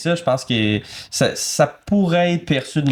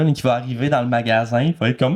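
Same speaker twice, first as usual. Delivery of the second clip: ordinary talk, nothing marked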